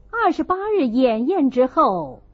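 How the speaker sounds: plain reading voice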